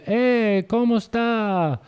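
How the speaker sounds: very high, loud, drawn-out Spanish hail; mock-friendly holler, stretched and singsong; imitated hearty greeting. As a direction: call out a hammed-up local greeting. Imitates locals greeting his friend's girlfriend.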